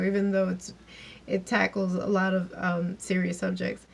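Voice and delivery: normal talking voice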